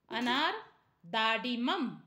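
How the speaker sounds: normal talking voice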